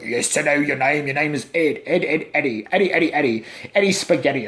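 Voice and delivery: put-on weird drunken voice; mock demon slurring like an old drunk. Demon answering the investigators, mock old drunken voice. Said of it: "your old, weird, drunken voice".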